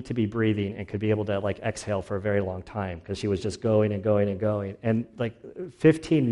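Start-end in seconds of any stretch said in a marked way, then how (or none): none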